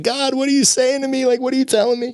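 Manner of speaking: high-pitched desperate appeal; retold crying plea. Man driving to grandma's asking God, retold tearful plea. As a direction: plead upward, reenacting a tearful moment. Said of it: "I was crying".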